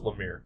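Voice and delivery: "Lemire" ending like "mere"; Lemire as luh-MEER. Shown as a demonstration. Host tells friends how he pronounces it, "Lemire" as luh-MEER. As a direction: say "Lemire" with an "ee" in the second syllable. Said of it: "I pronounce it Lemire".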